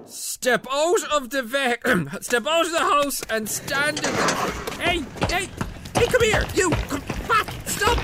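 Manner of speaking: high, loud cop shouting; police bust shout. Acted. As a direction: shout like bursting in for the arrest.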